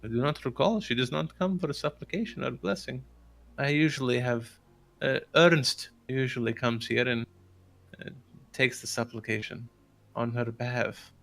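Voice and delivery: put-on accented, lilting cleric's voice; hesitant priest. Priest recalls the lady's rare church visits, accented lilting voice. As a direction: answer carefully as an old-world priest. Game master voices Lucian answering.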